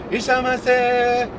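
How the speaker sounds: high, loud, slow singsong shop-clerk welcome; singsong shop greeting. Retold or imitated. Vlogger mimicking Japanese shop staff greeting customers, high drawn-out singsong. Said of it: "they always have to use this same voice".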